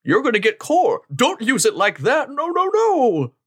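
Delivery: high, slow mock scolding. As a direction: wag a finger in a high voice. Voicing scolding character.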